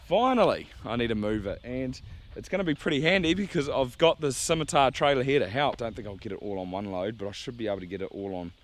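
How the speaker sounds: ordinary talk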